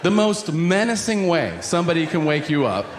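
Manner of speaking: ordinary talk, nothing marked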